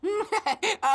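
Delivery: short high mocking chuckle; sly laugh. Fox laughs at the naive farm helper, sly chuckle.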